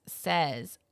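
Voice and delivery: ordinary talk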